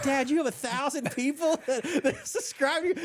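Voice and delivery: high-pitched, impressed kid imitation; proud kid. Dad quoting his proud kids, high and excited. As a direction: imitate a child amazed by Dad. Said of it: "They're so proud of me".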